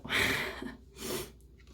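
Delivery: short, breathy embarrassed laugh. Real, not acted. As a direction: laugh off the mix-up. Laughing at her own slip.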